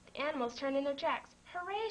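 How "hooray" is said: high cheering hooray; joyful shout. A narrator's reading. Rabbit cheers for the cow who'll join them, high glad shout. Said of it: "shouted the rabbit".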